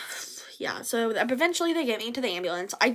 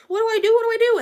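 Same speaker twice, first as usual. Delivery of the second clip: high, louder frantic repeat; stranded teen panicking after the wreck; panicked; 10 semitones higher, 6 dB louder, much faster than usual. Retold or imitated. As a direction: ask it over and over in high panic. Panicking at the scene.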